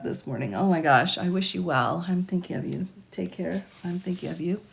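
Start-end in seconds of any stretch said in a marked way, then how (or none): none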